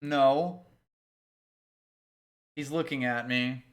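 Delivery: ordinary talk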